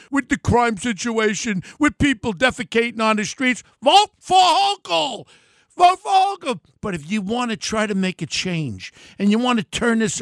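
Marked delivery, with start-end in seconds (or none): 3.8-6.6 high, loud, slow and dripping sarcasm; sarcastic mock endorsement. Mocking voters who like the crime, sarcastic higher, louder cry. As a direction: sell it like a fake cheerleader.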